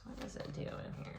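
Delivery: hushed question to herself; whispered aside. Real, not acted. Reader whispers to herself while pulling the card.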